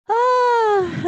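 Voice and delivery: high pained yelp into a cracking laugh; exasperated cry. Real, not acted. Teacher recalling swapped kitchens, high exasperated cry. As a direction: let the frustration burst out as a half-laugh.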